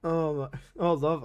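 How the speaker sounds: ordinary delivery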